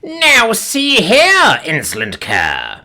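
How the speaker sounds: high, loud, haughty snarl; theatrical alien overlord outburst; snarling alien villain. A narrator's reading. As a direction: snarl it like an imperious supervillain, very drawn out. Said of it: "snarls the skinny Xeno".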